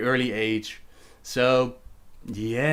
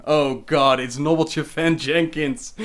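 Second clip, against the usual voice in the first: usual speaking voice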